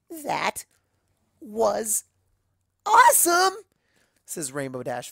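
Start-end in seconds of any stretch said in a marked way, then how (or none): 0.1-3.5 high, loud burst of awe; excited exclamation. Acted. Rainbow Dash bursting out after the long silence, thrilled shout. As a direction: let it explode on awesome.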